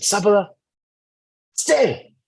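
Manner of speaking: firm command. Retold or imitated. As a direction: order her to stay, ringing out. Sage commands the cow to stay.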